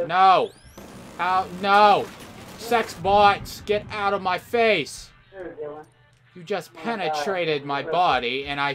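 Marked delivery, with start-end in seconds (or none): 0.1-2.0 shouted protest. Real, not acted. Dying in game. 2.6-5.1 mock angry yell. Yelling at enemy bot.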